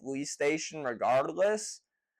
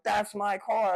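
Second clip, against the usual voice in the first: higher-pitched, mildly panicked insistence; retold freak-out; 5 semitones higher than usual. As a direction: re-enact a frantic plea without shouting. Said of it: "I'm freaking the fuck out".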